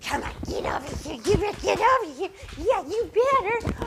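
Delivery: mock-warn the dog to rile him up; playful mock threat. Shown as a demonstration. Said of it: "get him ginned up and excited".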